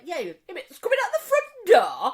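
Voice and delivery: high, loud insistence; worked-up comic complaint; retold exasperation. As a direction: insist impatiently to the repairman. Exasperated phone call with repairman.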